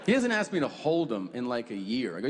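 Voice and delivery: ordinary talk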